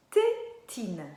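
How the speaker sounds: TÉTINE split, strong loud TÉ, short pause, TINE; syllable by syllable. Shown as a demonstration. Teacher giving children a new word, syllable by syllable. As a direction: punch the TÉ of TÉTINE, then TINE apart. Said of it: "par quoi est-ce que ça commence?".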